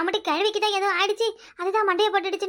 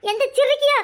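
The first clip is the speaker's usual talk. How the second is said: high, loud tearful outburst; wailing curse; 8 semitones higher, 5 dB louder than usual. Acted. Woman cursing whoever left the lemon, high tearful wail.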